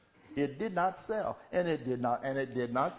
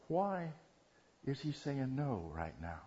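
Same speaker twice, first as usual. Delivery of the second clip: quiet musing prayer; 7 dB quieter than usual. Acted. Believer revisiting a prayer request, quiet musing. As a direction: drop it to a hushed, puzzled question.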